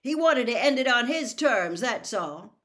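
loud, firm reply; shouting over noise. A narrator's reading. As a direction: call it back over a cheering crowd. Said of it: "Harry shouted back over all the noise".

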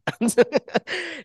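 high burst of laughter. Real, not acted. Laughing while telling the marathon story. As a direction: laugh out at the memory.

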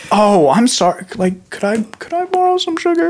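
high, sweetly innocent neighbor voice. Pretend. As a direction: play dumb in a high voice asking for sugar. Acted neighbor asking for sugar.

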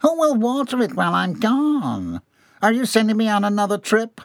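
high, plaintive questions; whimpering. A narrator's reading. Employee being dragged toward the doors, whimpering. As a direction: whine on helplessly, clueless. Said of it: "John continued to whimper".

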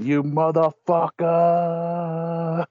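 very slow, drawn-out mock curse in an odd voice; hammed-up slow crazy-lady curse; imitating crazy lady. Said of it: "she starts going".

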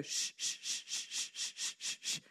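steady rhythmic run of shushes; soft repeated hushing; soothing baby shush. Retold or imitated. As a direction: shush a bouncing baby over and over. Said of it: "put him right on my shoulder".

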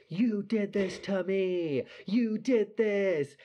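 high, loud, drawn-out mock wail; stretched-out, high-pitched tearful accusation, hammed up; drunk crying accusation. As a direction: imitate a drunk friend wailing blame. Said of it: "on the floor crying".

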